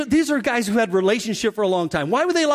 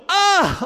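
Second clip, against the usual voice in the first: startled yelp; 9 semitones higher than usual. Retold or imitated. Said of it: "the disciples go".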